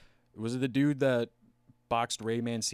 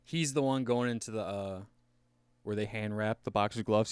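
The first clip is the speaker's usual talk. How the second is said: ordinary delivery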